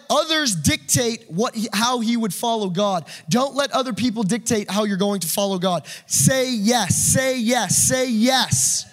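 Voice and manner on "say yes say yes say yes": urgent exhortation. Real, not acted. Preacher urging repeated call.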